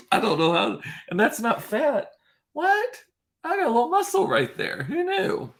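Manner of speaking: very high, squeaky mock amazement; playful mock surprise. Showing viewers a newly found muscle, mock surprised. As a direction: squeal in pretend disbelief.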